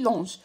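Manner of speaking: "لونج" ending on zh, like longe; soft G, wrong form. Shown as a demonstration. Teacher repeating the mistaken form, "لونج" with soft zh. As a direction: finish "لونج" with a soft French J. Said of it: "زدتش ال E وحدها".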